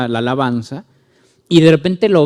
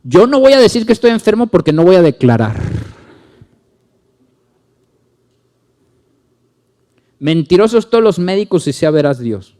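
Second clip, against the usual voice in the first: mock zealous believer; 5 semitones higher, 6 dB louder than usual. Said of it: "llegan y me dicen".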